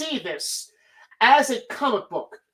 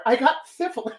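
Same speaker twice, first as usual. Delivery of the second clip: a hint of an odd put-on voice; deadpan confession in a silly voice; mock Thor reply; 3 semitones higher than usual. Thor admitting the joke punchline, lightly put-on voice. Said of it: "Oh, I'm Thor".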